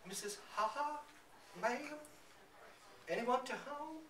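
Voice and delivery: high calling voice; an old woman calling at a stranger's door, high. Acted. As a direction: call out to the house. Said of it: "My friend calls".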